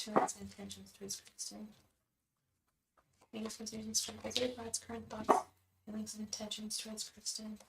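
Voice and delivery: soft, hushed chanting to the spirit guides; whispered invocation. Acted. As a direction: murmur the question over and over like a prayer. Tarot invocation repeated softly.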